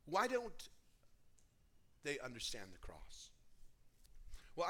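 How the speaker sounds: normal talking voice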